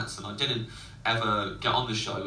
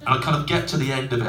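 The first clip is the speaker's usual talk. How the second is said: usual speaking voice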